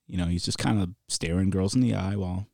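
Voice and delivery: normal talking voice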